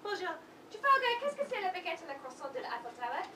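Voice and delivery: fast mock-French babble; fake French. Heiress babbling fake French at her fiance. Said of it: "she doesn't actually speak any English".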